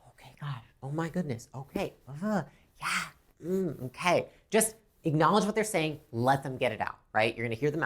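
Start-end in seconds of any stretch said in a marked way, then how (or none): 0.1-4.3 whispered little acknowledgments, much softer; soft short responses. Shown as a demonstration. Rep quietly acknowledging a venting customer, soft whispered murmurs. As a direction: murmur along, just letting them know you hear. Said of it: "Engage with body language and short responses".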